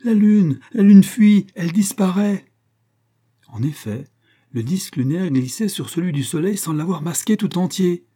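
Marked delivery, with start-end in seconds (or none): 0.0-2.5 high, very loud alarmed shouts; choked cry. A narrator's reading. Astronomer seeing the eclipse fail, choked high cry. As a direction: shout it out in panicked disbelief. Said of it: "s'écria-t-il d'une voix étranglée".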